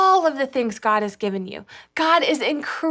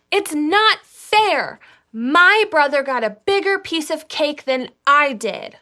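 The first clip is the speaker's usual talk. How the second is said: whiny voice; 4 semitones higher, 5 dB louder than usual. Shown as a demonstration. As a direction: whine it like a sulky kid. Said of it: "we have to do them in our whiniest voices".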